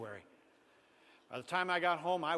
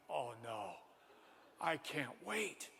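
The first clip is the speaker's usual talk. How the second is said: low, hushed inner groan; mock dread; 4 semitones lower, 10 dB quieter, slower than usual. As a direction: slowly mutter it to yourself in mild dread. Boy dreading the wait, to himself.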